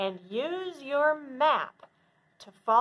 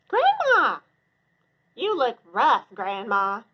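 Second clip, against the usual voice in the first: put-on little girl calling; 7 dB louder than usual. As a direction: call out brightly to grandma.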